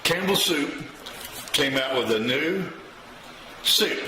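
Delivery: ordinary talk, nothing marked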